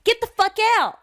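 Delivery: high, loud shouting; play-acted enraged yell; acted furious eviction. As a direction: scream at him to leave in a skit. Acts kicking boyfriend out.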